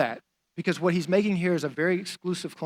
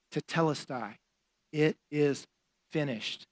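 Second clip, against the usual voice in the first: much softer, fading last breath; dying last words; 2 semitones lower, 5 dB quieter than usual. Acted. Jesus on the cross, breathing his last, hushed. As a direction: let it slip out on a final breath. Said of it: "he takes that one last breath".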